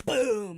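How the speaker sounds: loud, high exploding boom; booming impact. Retold or imitated. Chimichanga hitting dad's shoulder, loud explosive boom. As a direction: burst the word out like a hit.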